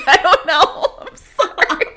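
giggling through an apology; high, laughing sorry. Retold or imitated. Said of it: "And I was like".

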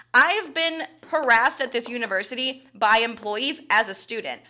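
ordinary delivery

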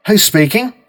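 policeman on the line. A narrator's reading. Police officer asking who calls, lightly voiced. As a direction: ask it briskly.

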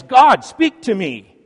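man yelling at God to speak. A narrator's reading. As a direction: shout it up to God. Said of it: "the man yells".

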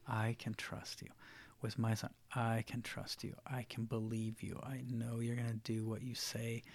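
a hush of quiet trust; daughter's unspoken thought of trust; soft inner voice. Retold or imitated. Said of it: "she knew".

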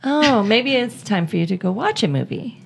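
usual speaking voice

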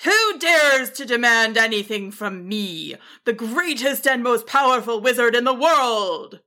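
mild mock grumbling yell from a proud wizard; a touch of pompous indignation; lightly voiced wizard, grumbling yell. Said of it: "a grumbling yell".

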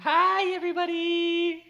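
high, loud, drawn-out mock greeting; mimicking wife's greeting. Mimics wife's catchphrase on request.

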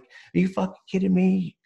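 exasperated disbelief. Retold or imitated. Brother left him at the stadium, exasperated yell.

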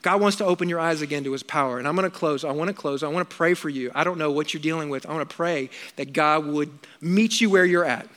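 hushed pastoral prayer lead-in; soft prayer voice. Acted. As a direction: draw the room in quietly before praying.

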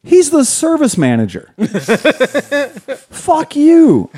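high, laughing mock outrage; high laughing mock fury at the boss. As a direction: play up outrage at being outdone. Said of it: "and I'm like".

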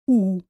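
U said as "oo"; a pure Spanish vowel "oo" for U. Shown as a demonstration. Teacher isolates the vowel, U said as "oo". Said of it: "and the U".

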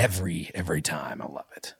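low country singer fading into a whisper. Acted. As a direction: breathe it out adoringly. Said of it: "can you give us some Garth Brooks?".

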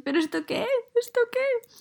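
high, squeaky mock outrage; comically indignant high-pitched questioning; exasperated disbelief. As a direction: protest in hammed-up dismay, pitch climbing on the repeat. Acted reaction to bad school news.

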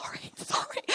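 sheepish stage whisper; acted hushed apology.

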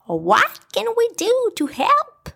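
high, eager question; eager friend. A narrator's reading. A friend offering to help Eeyore, high and eager. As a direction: lift it high and keen. Character asks.

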